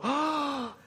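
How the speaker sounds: high, hushed shocked oh; mock shocked oh. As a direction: react like a polite guest taken aback. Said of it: "my Canadian friends will walk in and go".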